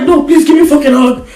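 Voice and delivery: mock creepy demand. Friend repeats the stranger's demand for her listener, high and loud. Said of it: "He said it with some crazy eyes".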